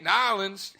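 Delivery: loud, high, exaggerated "Nolans"; mock local pronunciation. Mocking his friend's correction, exaggerated local "Nolans". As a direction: belt out "Nolans" as two drawled syllables. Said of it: "that's how you say it".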